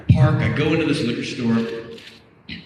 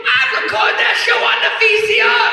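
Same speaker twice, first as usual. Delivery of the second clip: very high, hollering impersonation; sustained high-pitched screech; excited woman stranger. Retold or imitated. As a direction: screech the explanation, mimicking her. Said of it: "She goes".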